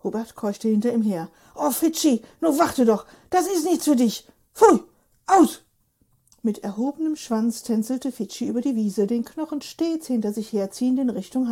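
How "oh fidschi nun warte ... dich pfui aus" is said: raised, higher calls after a runaway dog; panting, pleading calls to the dog; calling after the dog. Acted. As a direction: shout the dog back, exasperated. Hubert calls after his dog.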